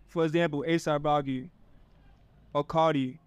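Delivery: usual speaking voice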